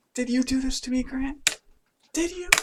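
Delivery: put-on a girl who learns she was deleted, rising disbelief; betrayed accusing girl. Monika confronting Grant for deleting her, high hurt accusation.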